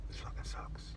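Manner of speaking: whispered grumble under the breath; very soft, unvoiced aside; muttered complaint. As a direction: grumble it to yourself, barely audible. Muttered complaint after the joke.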